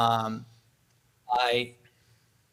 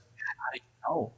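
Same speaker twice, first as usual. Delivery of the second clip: soft whisper; 7 dB quieter, slower than usual. Retold or imitated. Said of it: "she's like".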